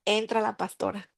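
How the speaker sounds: usual speaking voice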